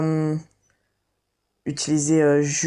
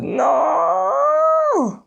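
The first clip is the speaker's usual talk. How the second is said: mock despair; 13 semitones higher, 5 dB louder than usual. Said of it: "j'étais en mode".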